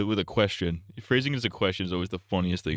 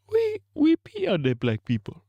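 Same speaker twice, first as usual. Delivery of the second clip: mock Nigerian accent, very high and louder, sliding down; 3 dB louder than usual. Streamer's mock Nigerian accent questioning Kwanzaa, very high.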